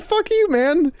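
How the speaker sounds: high squeal through laughter; laughing shriek. Retold or imitated. Cursing at his teammate while laughing, high squeal.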